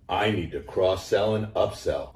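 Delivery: ordinary delivery, nothing marked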